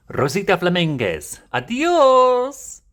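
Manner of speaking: high, showy Spanish announcement; Spanish accent. Acted. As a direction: announce with a flourish, ending in a loud high cry. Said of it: "maybe Espanol accenta".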